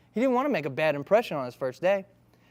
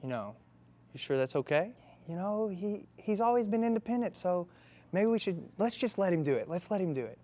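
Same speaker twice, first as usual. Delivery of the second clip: hushed parents; 3 semitones higher, 7 dB quieter than usual. Acted. Parents whisper to each other.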